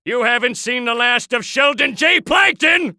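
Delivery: rising theatrical boast; defiant vow. Acted. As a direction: declare it as a villain's grand threat. Villain's defiant parting vow.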